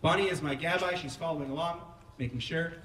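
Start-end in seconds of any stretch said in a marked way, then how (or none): none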